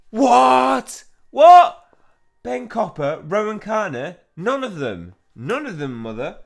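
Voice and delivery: very high, shouted shock; horrified outburst. Acted. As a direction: cry out in horrified refusal. Horrified answer to villain's threat.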